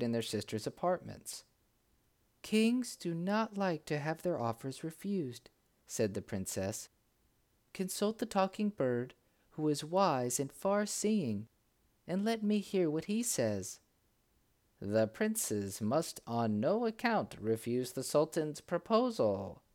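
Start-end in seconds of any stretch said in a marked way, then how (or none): 2.4-5.5 high, sweet princess voice, lightly done; a storybook princess, high and light; cartoon princess. 7.7-13.8 high, sweet princess voice, lightly done; a storybook princess, high and light; cartoon princess. 14.8-19.6 slow, measured wise bird; slow, deliberate talking bird. Acted.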